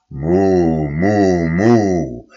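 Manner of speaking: loud cow moo, drawn out; bellowing moo. A narrator's reading. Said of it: "brüllte Mairus".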